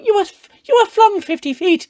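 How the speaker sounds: high, loud hammed-up scolding lady; mock Romulan lady. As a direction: squeal it out like a panicked caricature. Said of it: "the Romulan lady's like".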